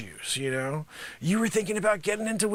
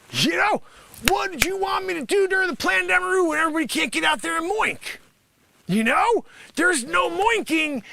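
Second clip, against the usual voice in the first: exasperated shout; 8 semitones higher than usual. Acted. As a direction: fire it off at them in frustration.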